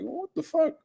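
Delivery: high, squeaky what-the-hell; mock disbelief. Streamer reacting to the fighter's words, high disbelief. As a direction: squeak it out in shock. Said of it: "I'm like".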